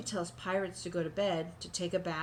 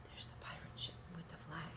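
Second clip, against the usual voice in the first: whisper; 3 semitones lower, 15 dB quieter than usual. Real, not acted. Aside while showing the picture.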